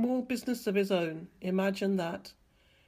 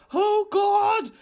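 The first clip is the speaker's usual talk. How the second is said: high, loud cry of alarm; stammered shock; 12 semitones higher, 8 dB louder than usual. A narrator's reading. Hank seeing the creature on his line, stammered in shock. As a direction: cry out, overwhelmed by the sight. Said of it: "stammered Hank".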